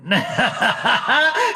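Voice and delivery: high, rising villain giggle; delighted cackle. Acted. Scientist eyeing a promising specimen, delighted cackle.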